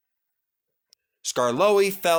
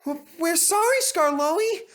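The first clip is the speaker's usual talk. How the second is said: high nervous stammer. Acted. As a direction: stutter it out, frightened. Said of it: "stammered the coaches".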